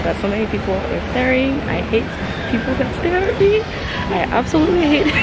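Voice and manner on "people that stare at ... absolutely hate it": high-pitched exasperated complaint. Real, not acted. Vlogger complains about people staring at her, exasperated.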